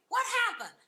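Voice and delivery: high, loud alarmed cry; alarmed call. Retold or imitated. Mother at the well calling out, high and loud.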